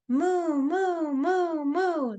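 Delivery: high playful mooing; cow mooing. Pretend. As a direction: moo like a cartoon cow. Cow sound in kids' rhyme.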